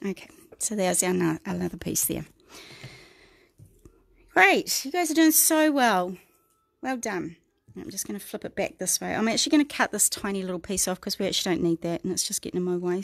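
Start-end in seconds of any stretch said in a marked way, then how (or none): 4.3-7.4 cheery praise. Real, not acted. Praising viewers, pitch jumps up.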